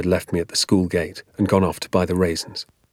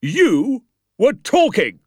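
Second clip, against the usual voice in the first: slow, high, loud bark; drawn-out parade-ground shout; barked accusation; 14 semitones higher, 4 dB louder, much slower than usual. A narrator's reading. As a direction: bark each word at the culprit. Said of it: "he barked".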